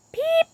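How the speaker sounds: squeaky bird peep, very high; very high annoying chirp. A narrator's reading. Nick's piercing blackbird chirp in silent reading. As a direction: chirp as shrill as you can. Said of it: "highest and most annoying chirp of all".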